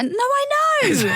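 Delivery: high-pitched indignant squeal; exasperated protest. Real, not acted. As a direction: voice shoots up in protest. Co-host protests at being corrected.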